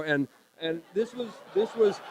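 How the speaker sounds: real laughter breaking into the words; too amused to finish, pitch rising; laughing. Real, not acted. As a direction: start the sentence and crack up. Laughs while recalling the absurd injury.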